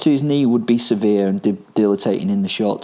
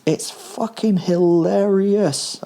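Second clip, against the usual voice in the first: high, drawn-out delight without laughter; amused exclamation; 11 semitones higher, much slower than usual. A narrator's reading. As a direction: savour it slowly, pitched way up. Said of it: "I laughed".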